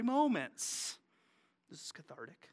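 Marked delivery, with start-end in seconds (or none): 1.7-2.5 much softer, low confession; quiet aside. Real, not acted. Pastor admitting angry moments, quietly. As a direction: mutter it to yourself.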